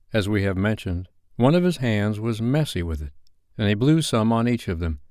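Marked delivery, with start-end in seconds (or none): none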